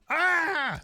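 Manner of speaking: high, loud interrupting shriek; mock horrified scream. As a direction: scream over the unsayable word, hammed-up. [name]'s scream cuts the quote off.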